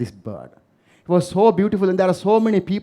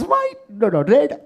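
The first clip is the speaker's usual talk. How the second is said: eager audience answer; 8 semitones higher, 5 dB louder, slower than usual. Retold or imitated. Audience answering the flag question.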